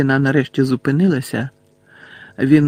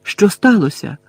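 higher-pitched, anxious question; frightened; 6 semitones higher than usual. A narrator's reading. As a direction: ask in sudden alarm. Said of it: "злякано запитав Гаррі".

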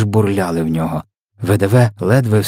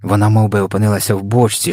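ordinary delivery, nothing marked

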